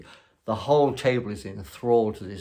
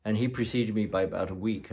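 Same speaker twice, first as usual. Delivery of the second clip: usual speaking voice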